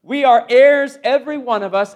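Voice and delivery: usual speaking voice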